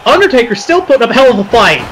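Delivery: fast, rising play-by-play call; wrestling commentator. Acted. Mock commentary of a game match.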